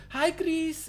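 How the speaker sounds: high, loud sing-out greeting; mock flirty greeting. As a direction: imitate her calling out in a high voice. Imitates neighbor greeting him.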